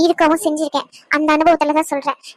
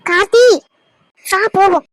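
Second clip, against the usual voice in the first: firm urging; 3 semitones higher, 5 dB louder than usual. Acted. Pulling him away firmly.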